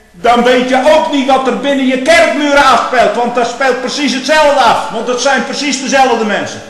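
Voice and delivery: loud, high pulpit cry; preaching at full force. Acted. Preacher crying out against the churches at full force. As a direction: shout it over the congregation.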